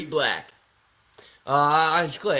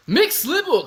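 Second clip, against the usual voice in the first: excited call-out; 12 semitones higher, 8 dB louder than usual. Real, not acted. Greeting a chat member, calling out.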